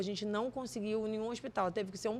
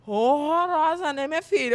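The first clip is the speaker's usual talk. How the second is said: teasing mother; 5 semitones higher, 8 dB louder than usual. Retold or imitated. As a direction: drag out the word to tease your daughter. Mother teasing about ugly sandal.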